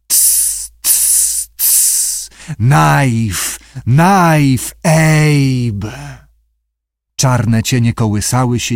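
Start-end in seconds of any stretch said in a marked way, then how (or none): 0.1-2.3 voiceless "ts" hisses; creature's hiss. Acted. Głosy stworzeń z morza. 2.6-6.2 long, stretched creature cries; sea creature voice. Acted. Stwory z morza wołają.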